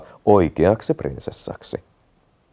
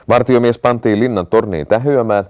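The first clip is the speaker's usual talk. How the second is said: usual speaking voice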